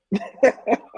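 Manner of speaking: burst of laughter. Real, not acted. Laughs at his own boast.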